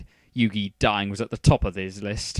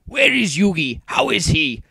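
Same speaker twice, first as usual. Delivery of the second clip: loud, higher-pitched questioning; urgent demand; 7 semitones higher, 7 dB louder than usual. A narrator's reading. Grandfather running up to the teenagers, loud demanding. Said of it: "Solomon demanded".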